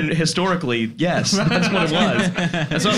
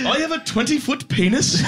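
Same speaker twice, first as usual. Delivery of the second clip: higher-pitched British cop voice; comic British accent put on high; Owen Smith impression; 9 semitones higher than usual. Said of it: "my best British accent is a British cop voice".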